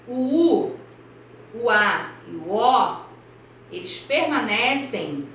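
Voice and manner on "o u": usual speaking voice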